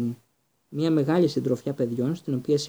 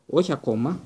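usual speaking voice

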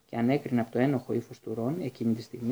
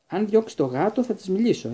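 higher, louder, a flare of temper; angry; 8 semitones higher, 5 dB louder than usual. A narrator's reading. A touch of heated anger in the voice. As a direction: Ron snaps back at Hagrid about the cat, angrily. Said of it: "είπε θυμωμένος ο Ρον".

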